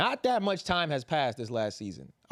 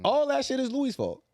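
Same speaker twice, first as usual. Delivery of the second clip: exasperated outburst; 10 semitones higher than usual. Real, not acted. Fan ranting to friends that it's all Louis, exasperated outburst. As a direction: throw up your hands and blame him.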